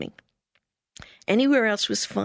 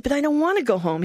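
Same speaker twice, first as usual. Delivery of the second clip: higher, fast protest; 7 semitones higher, faster than usual. Retold or imitated. Missionary protesting to the president, higher. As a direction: object quickly. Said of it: "And I said".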